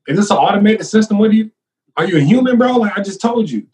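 retold protest, fed up and pleading to be heard; exasperated retort. Retelling his protest to police.

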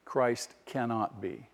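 hushed, slow conclusion; quiet, slow. Acted. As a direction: drop the voice on "Christ cannot be". Quiet slow sermon punchline.

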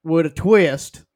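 hammed-up country accent; country drawl. Shown as a demonstration. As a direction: demo a lazy Southern twang with trailing phrases. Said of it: "then just don't finish it".

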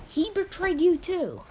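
high, loud, accusing; angry retort. Acted. Petey warns his kid about Grandpa, angry. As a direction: push the point home angrily. Acted quarrel over betrayal by Grandpa.

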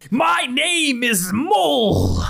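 high, drawn-out proclamation; slow, high bellowed introduction; grand declaration. Acted. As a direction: announce yourself like an exasperated god. Mole god announces his name.

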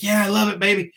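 officer loving the rocket fire, mock gleeful and high. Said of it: "It's like".

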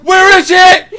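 high loud frantic yelling; panicked shout. Retold or imitated. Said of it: "mid-ride she'll be like".

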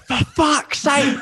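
high, slow, exasperated yell; frustrated shout. Retold or imitated. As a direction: belt it out in disbelief. Mock frustrated outburst from a friend.

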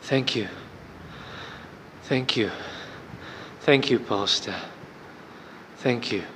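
soft, low and spent; hushed thanks with long pauses between; quiet voice. Retold or imitated. Calmed-down woman thanking the pastor, in a quiet voice. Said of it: "in a quiet voice".

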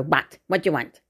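curt, impolite phone answer; snippy mum. Retold or imitated. Joy answering the hotel phone, snippy. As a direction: imitate a mother who can't be bothered being polite. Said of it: "Like that".

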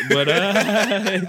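high, loud words breaking into real laughter; laughing. Real, not acted. Guest cracking up with host over his own fat joke, laughing. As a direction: talk through a burst of laughter.

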